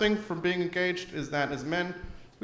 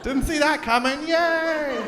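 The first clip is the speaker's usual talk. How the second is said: fake surprise; 9 semitones higher, slower than usual. Woman pretends to be surprised.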